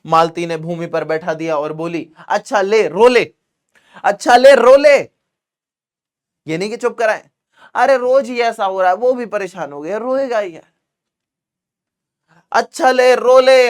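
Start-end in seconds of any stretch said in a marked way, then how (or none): 2.3-5.0 growing louder, higher, exasperated. Shown as a demonstration. Mother snaps at the crying child, getting louder. 12.5-13.7 exasperated. Shown as a demonstration. Mother exasperated with crying child.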